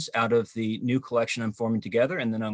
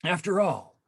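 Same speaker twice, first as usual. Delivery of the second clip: measured, declamatory poem reading, a bit louder; poetry reading; 3 semitones higher, 4 dB louder than usual. Acted. Poet reading his new poem to the online audience, measured and declamatory. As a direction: read the poem to the audience with weight.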